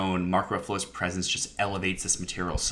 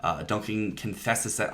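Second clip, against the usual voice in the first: normal talking voice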